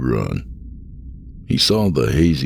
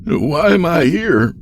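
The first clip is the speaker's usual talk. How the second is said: high, slow, louder cry; distressed plea; 5 dB louder, slower than usual. Acted. Andy demands an explanation from the old man, distressed plea. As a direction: plead for an answer in a raised pitch.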